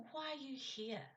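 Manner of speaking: high-pitched question falling away at "here"; changed tone. Shown as a demonstration. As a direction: start "Why" high and fall through to "here". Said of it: "When you change your tone".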